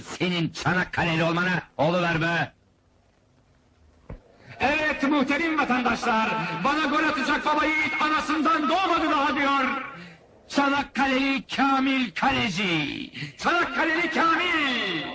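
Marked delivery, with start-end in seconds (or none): 4.6-15.0 high, ringing showman's announcement; barker's hype. Acted. Boss daring citizens to score on his keeper, barker's hype. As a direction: hype the champion to the whole street. Boss hypes goalkeeper to crowd.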